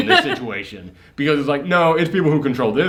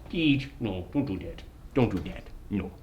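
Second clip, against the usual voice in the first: low, much softer real aside; quiet scolding aside; 6 semitones lower, 13 dB quieter than usual. Real, not acted. Owner to dog shaking the camera, low quiet scolding. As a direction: turn away and softly tell off a pet.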